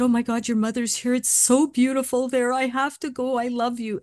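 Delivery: high, rapturous retelling; excited dying father. Retold or imitated. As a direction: act out joyful amazement. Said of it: "her father was so excited".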